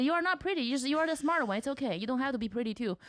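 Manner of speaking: imitating mom.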